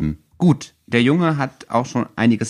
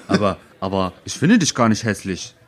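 halting, mildly baffled protest; understated bewildered denial; perplexed. Acted. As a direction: restart the word, faintly puzzled. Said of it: "sagte Harry perplex".

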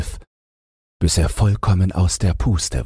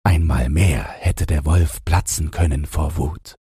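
plain reading voice

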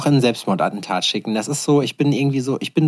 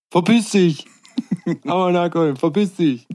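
mock angry shout; 6 semitones higher than usual. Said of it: "so redet man in Berlin".

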